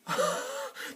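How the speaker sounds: airless high laugh; silent laugh. Real, not acted. Laughing at Spider-Man three joke.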